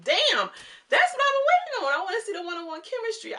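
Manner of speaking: loud and high, bursting with excitement; excited. Real, not acted. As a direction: shout it like you can't wait. Excited about upcoming dates.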